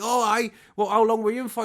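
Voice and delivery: a lightly voiced prisoner asking back; mock surprised ex-inmate; released prisoner. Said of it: "And they're like".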